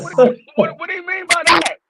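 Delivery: high, incredulous retold question; high-pitched bewildered protest; baffled demand. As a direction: act out alarmed confusion, voice climbing. Retold questioning of the recruiter's words.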